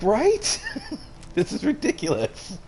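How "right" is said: high agreeing right. Retold or imitated. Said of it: "I was like".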